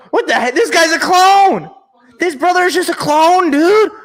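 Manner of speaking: high, loud disbelief; loud, high-pitched freak-out; astonished outburst. Real, not acted. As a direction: exclaim in real shock, pitch shooting way up. Astonished at seeing the player's brother.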